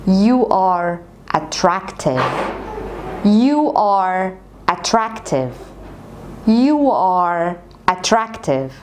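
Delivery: very slow, stretched repetitions; slow drill. Shown as a demonstration. Teacher drilling the sentence for students, very slow. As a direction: drill it slowly word by word. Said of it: "احفظ الجملة زي ما أنا بقولها لك كده".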